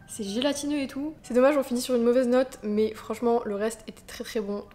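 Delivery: ordinary delivery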